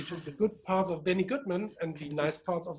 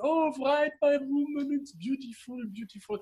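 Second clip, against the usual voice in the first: put-on Italian accent; 9 semitones higher than usual. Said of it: "said with Italian accent".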